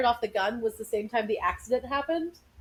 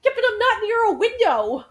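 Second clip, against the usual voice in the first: baffled protest; 12 semitones higher, 6 dB louder than usual. Real, not acted. Sudden blinding light.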